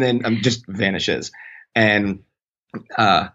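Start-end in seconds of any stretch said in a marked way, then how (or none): none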